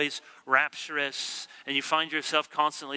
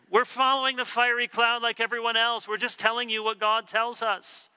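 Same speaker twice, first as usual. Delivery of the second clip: voicing Moses and Aaron; 6 semitones higher than usual. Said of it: "They said".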